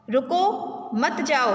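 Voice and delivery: slow रुको, a break, then मत जाओ as one phrase; drawn-out teaching demo, pausing after रुको; pause after रुको. Shown as a demonstration. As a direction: separate रुको from मत जाओ with a clear stop. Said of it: "रुको के बाद अगर हमने विराम चिन्ह".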